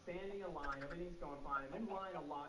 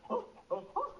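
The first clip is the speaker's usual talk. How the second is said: startled gasp; 8 dB louder than usual. Retold or imitated. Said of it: "she goes".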